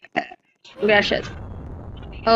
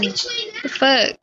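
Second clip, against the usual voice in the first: shocked outburst; 11 semitones higher, 3 dB quieter than usual. Real, not acted. Sudden lag death surprise.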